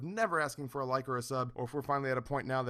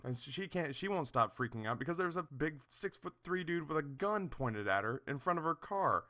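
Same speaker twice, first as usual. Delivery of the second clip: normal talking voice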